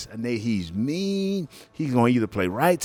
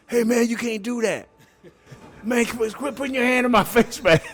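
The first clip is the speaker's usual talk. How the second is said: whiny opponent; 11 semitones higher than usual. Retold or imitated. Opponent complaining during the game.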